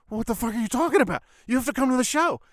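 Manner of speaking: high, fast, loud mock indignation; mock outraged yell. Friend yelling at him for skipping the show, high and fast. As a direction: shout it at a friend who's bailing.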